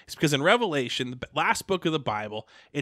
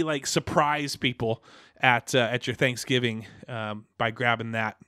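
normal talking voice

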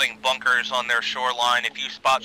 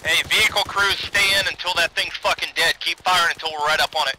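raised voice over the radio; calling out; 5 semitones higher, 4 dB louder than usual. Real, not acted. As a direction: call it out to the crews. Calling out orders to vehicles.

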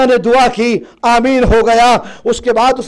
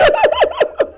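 a sharp high sob breaking out; sudden high wail; 3 dB quieter than usual. Acted. Zainab wailing as she questions the old servant. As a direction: let a wail escape mid-question. Grieving cry in lament.

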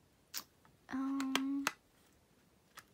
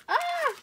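short, very high squeal; delighted squeal. Real, not acted. Thanking a friend for the cute gift, delighted squeal. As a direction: squeal at something adorable.

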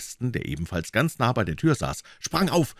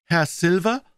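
put-on higher, louder questioning boy voice; fragender Junge; 11 semitones higher, 4 dB louder than usual. A narrator's reading. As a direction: pipe it up as a polite question. Boy hands note and asks. (fragende Jungenstimme)

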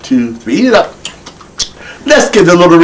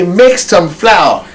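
very slow "Make some flour", "make" stressed and falling; slow model line; 3 semitones higher, much slower than usual. Shown as a demonstration. Teacher models it slowly for the child to repeat.

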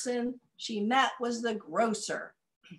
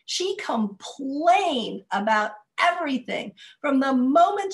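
big, loud storytelling voice; story narration; 4 semitones higher, 8 dB louder than usual. A narrator's reading. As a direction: tell it out to a crowd of kids.

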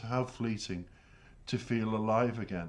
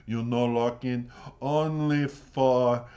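louder mock Italian accent, the second part drawn out slowly; an Italian accent, stretching out only for; imitating Italian partner; 5 dB louder, slower than usual. Partner scolding the narrator for disco, in a mock Italian accent. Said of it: "he goes".

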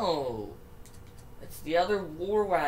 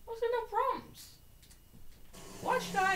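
voice crack; 3 dB quieter than usual. Real, not acted. Commentating a race, voice suddenly cracking high. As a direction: voice cracks way up mid-question. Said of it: "Random voice cracks are just happening".

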